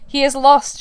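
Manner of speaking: loud, high, commanding; imperious. A narrator's reading. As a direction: command it like a proud mother. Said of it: "she answered imperiously".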